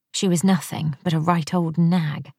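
ordinary delivery

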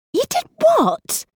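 shocked squeak, very high and drawn out. Acted. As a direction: squeak it in total disbelief. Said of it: "she squeaked again".

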